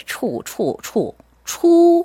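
초 as in 초리닝, held on a high level tone; Chinese "chu" sound. Shown as a demonstration. Teacher showing co-hosts today's Chinese word, pronunciation demo. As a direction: say a clear, lifted 초, not 추. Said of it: "요 발음은요".

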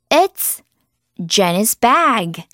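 very slow, clear example; slow drill. Shown as a demonstration. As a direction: model it slowly. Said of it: "repeat drill".

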